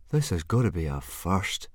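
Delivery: lazily drawled remark. A narrator's reading. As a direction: draw the words out dryly. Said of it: "drawled Fishlegs".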